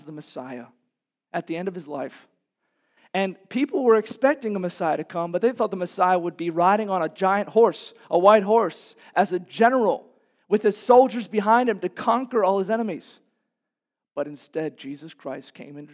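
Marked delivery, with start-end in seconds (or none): 3.1-13.2 high, loud, driving sermon voice; rising to a pulpit roar; fiery preaching. Acted. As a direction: preach it hard to the congregation. Preacher describes expected conquering Messiah.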